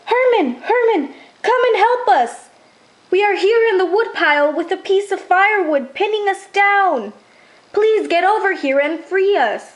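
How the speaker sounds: high, pleading cries for help; frightened calling. A narrator's reading. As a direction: call out trapped and scared. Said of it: "Hester's voice calling".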